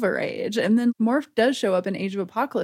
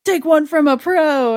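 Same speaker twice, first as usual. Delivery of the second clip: louder, higher mock-heroic boast; cocky hero call; 6 semitones higher, 7 dB louder than usual. Cyclops showing off to Jubilee.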